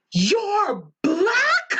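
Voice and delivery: a high, incredulous gasp of a question; stunned disbelief. Retold or imitated. Coworker stunned at first meeting.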